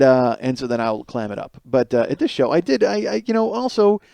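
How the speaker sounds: normal talking voice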